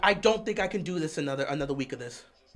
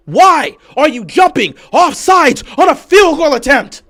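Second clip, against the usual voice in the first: slow, high, word-by-word yell; exasperated yelling; 8 semitones higher, 14 dB louder, slower than usual. Real, not acted. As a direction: hammer out every word at full volume. Repeats question, slower and louder.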